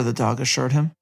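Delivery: ordinary talk, nothing marked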